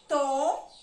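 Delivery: "To" drawn out alone, loud; teacher loudly marking the syllable "To"; syllable demonstration. Shown as a demonstration. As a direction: sound out "To" as one long, separate syllable. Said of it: "Eu vou marcar a sílaba".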